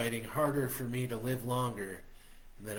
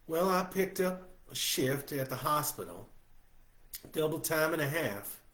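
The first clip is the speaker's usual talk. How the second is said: Josie's voice; 5 semitones higher than usual. A narrator's reading. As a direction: ask eagerly as an older woman.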